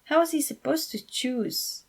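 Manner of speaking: plain reading voice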